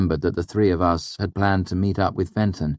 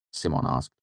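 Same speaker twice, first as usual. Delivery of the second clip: ordinary delivery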